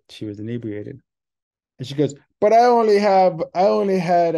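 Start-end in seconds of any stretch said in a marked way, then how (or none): 2.4-4.4 high, loud slurred drunk imitation. Drunk lady protesting to the gate agent, high, loud, slurring. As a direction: play the tipsy passenger protesting her one drink. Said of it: "you know, drunk voice".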